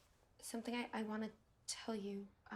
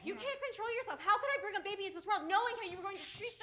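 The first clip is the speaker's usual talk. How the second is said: louder taunts; defiant shouting; 4 semitones higher, 5 dB louder than usual. Acted. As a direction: provoke him. She taunts him.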